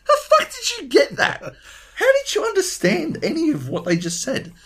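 very high, cracking with laughter; laughing disbelief. Retold or imitated. Mates asking Luke how he understood, high laughing disbelief. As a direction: squeal the question through laughter. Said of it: "And we're like".

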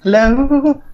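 high-pitched glitchy audio; chipmunk-like squeaky voice; helium voice. Real, not acted. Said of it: "Sound like you're on helium".

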